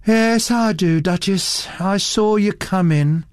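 bored, lazily drawn-out reply; lazy indifferent drawl. A narrator's reading. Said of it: "She drawled the words lazily, with a complete indifference".

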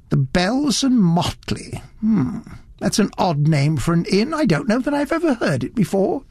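a hint of a fussy elderly gent, curious; fussy gentleman. Fussy little gentleman voiced.

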